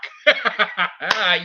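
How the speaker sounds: loud, high burst of laughter; delighted laugh. Real, not acted. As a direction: crack up in real delight. Delighted by the lock routine.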